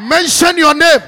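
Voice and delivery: full loud challenging shout. Retold or imitated. Alone in his room, shouting at a dream spirit. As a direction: bellow it at the intruder. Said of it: "then I shouted".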